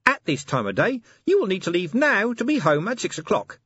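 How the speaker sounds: high small fussy imp; a tiny gadget imp. Acted. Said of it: "said the imp".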